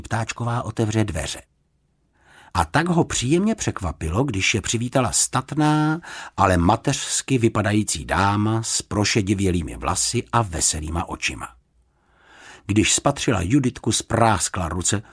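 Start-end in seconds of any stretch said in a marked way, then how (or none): none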